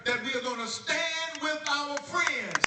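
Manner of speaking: builds to a soaring high shout; rally speech building to a loud, high cry; rising rally shout. Real, not acted. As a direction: climb until the crowd roars. Rally climax, crowd cheering.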